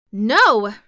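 loud, bold refusal; boldly. A narrator's reading. Ozma defying the king, bold loud no. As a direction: throw the word back defiantly. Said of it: "boldly answering the king".

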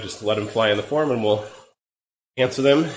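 hoarse, groggy cold voice; sick voice. Real, not acted. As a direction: talk through a head cold. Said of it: "my groggy and cold and sick voice".

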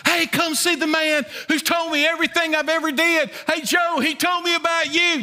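high-pitched excited call to the whole town; hollering. Retold or imitated. Woman running into town, hollering to neighbors.

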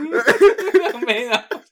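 high, slow, laughing through the words. Real, not acted. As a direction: laugh it out, amused. Mocking friend's cubicle office.

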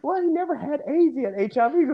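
high-pitched quibbling comeback; mock protesting. Acted Scottie arguing a technicality, high mock protest. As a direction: argue the point in a high, put-on voice. Acted back-and-forth argument about a claim.

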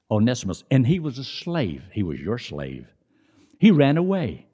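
normal talking voice

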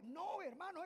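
ordinary delivery

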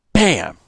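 high, loud shotgun blast; gunshot. Acted. Narrator voicing the shotgun firing at snake. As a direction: shout it like the gun going off.

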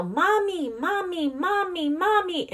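put-on high, repeated toddler whine of mommy; toddler calling mommy. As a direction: imitate a toddler calling for mom. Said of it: "And she'll go".

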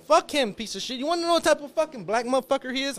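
high, loud angry rant; fired-up loud tirade; heated rant. Real, not acted. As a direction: tear into him, worked up. Ranting about hated ex-athlete.

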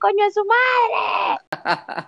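slow, high bellowed curse from the little driver; mock angry shout. Little old driver yelling at the passengers, hammed-up shout. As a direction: roar it out like a furious tiny old lady. Driver cursing at them from car.

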